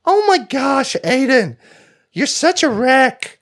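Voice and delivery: high, nasal-free mock Jersey dame; bad Jersey accent. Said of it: "me doing a bad impression of someone with a Jersey accent".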